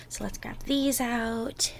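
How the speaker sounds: slow, soft whisper. Acted. Partner starting the baking together, slow soft whisper. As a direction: whisper gently as if not to wake anyone.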